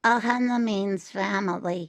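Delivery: lightly voiced cute little creature; cute character voice. Shown as a demonstration. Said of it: "really cute little character voice".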